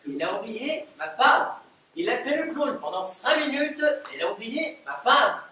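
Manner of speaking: loud angry outburst; furious. Acted. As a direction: explode at the idiot's blunder. Furious at the botched call.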